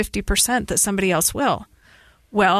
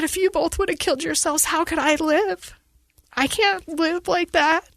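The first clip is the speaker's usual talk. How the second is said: anguished plea; 6 semitones higher than usual. Real, not acted. Mother's anguished reply to daughter.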